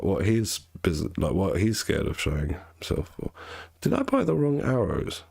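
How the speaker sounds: normal talking voice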